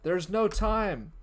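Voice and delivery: high, loud, slow ominous voice; slow and urgent, pitched high; unknown voice. Acted. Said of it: "unknown voice says".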